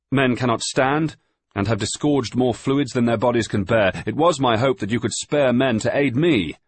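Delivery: loud, high voices against the wind; shouting over the gale. A narrator's reading. Surgeon and master arguing on deck in a gale, shouting. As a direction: keep yelling to be heard in the storm.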